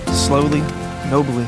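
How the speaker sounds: plain reading voice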